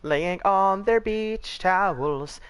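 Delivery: high, slow mock-poetic narration; dramatic recitation. Mocking pale beachgoers, he recites a slow, high ode.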